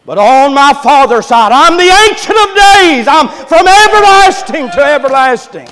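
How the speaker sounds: very high, loud preacher's climax; triumphant shouted proclamation. Acted. Boy Jesus telling doctors his eternal age, shouted in triumph. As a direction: shout it out as a mighty declaration. Climax of the boy's divine answer.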